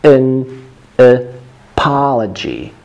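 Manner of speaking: broken into slow separate pieces; very slow, spaced-out pronunciation; slow syllable demo. Shown as a demonstration. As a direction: model it piece by piece for learners. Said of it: "this word apology is very important".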